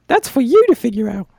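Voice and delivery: tiny high-pitched insect voice; high squeaky cricket voice. Acted. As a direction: squeak out the order.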